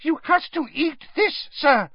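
very high elf squeak; high-pitched eager elf voice; Dobby. A narrator's reading. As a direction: squeak an earnest instruction. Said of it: "squeaked the elf".

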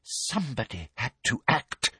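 soft, menacing near-murmur; hushed self-justifying villain; breathed. A narrator's reading. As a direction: breathe it out softly. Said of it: "breathed Umbridge".

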